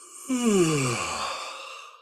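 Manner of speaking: loud yawn falling away; guided yawn. Shown as a demonstration. Coach leading the class's first yawn, loud and long. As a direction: stretch out a full yawn. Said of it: "try and trigger that yawn".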